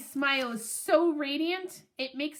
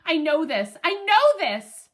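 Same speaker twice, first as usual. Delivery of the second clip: building from keen to louder, higher glee; eager excitement; 3 semitones higher, 7 dB louder than usual. A narrator's reading. Dog recognizes the command from Hattie, excitedly. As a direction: say it twice, more thrilled the second time.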